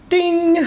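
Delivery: high bright ding like a text alert; phone ding sound. Retold or imitated. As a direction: sing out a phone's message chime. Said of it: "as your mobile phone does when it gets a message".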